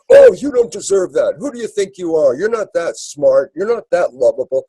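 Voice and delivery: a high, mocking parental scold; critical parent. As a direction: put down the child like a disapproving parent. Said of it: "critical approach that our parents gave".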